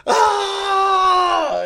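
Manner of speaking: piercing high scream, loud. Retold or imitated. Reenacting his jump-scare scream for his co-host. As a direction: shriek like you got jump-scared. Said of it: "And I'm just like".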